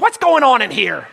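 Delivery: mock parental shout; alarmed mom, imitated. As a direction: burst in like a startled mother. Mom bursting in on crying kids.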